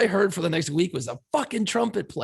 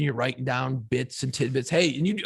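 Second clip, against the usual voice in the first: normal talking voice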